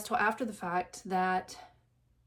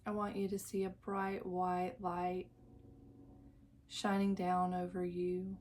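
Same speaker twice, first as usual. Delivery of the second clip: guided meditation; 6 dB quieter than usual. Acted. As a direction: lull the listener into calm, drawing out each phrase. Leads a guided visualization.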